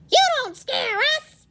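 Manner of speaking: high, loud mocking crow voice; taunting crow. Crow taunting scarecrow, high and mocking. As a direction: sneer it like a cheeky bird.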